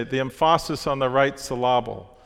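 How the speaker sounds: "emphasis" said as em-FAH-sis, "syllable" as sil-LAH-bul; joking em-FAH-sis on the right sil-LAH-bul, for "emphasis" and "syllable"; jokingly misplaced stress. Shown as a demonstration. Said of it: "friends used to say".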